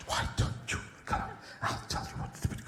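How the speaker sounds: breathy whisper right into the horse's ear. Retold or imitated. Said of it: "horse whisperers".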